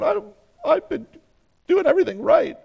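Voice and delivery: high, pouting whine with lip out. Pretend. Elijah pouting to God that he did everything right, high whine. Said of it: "We can see the bottom lip out saying".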